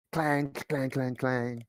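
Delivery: high-pitched clang, clang, clang; imitated metal clanging. Game master mimics a dropped metal object, imitated clanging. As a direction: mimic metal clattering on the floor. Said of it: "you hear a clash of, like, um, like, a metal ob".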